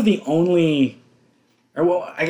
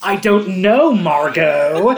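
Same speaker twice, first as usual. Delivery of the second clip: loud, high mock-snippy retort; exasperated movie husband; 4 semitones higher, 8 dB louder, slower than usual. Husband snapping back at Margot, loud mock exasperation. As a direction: quote the husband, raised and exasperated.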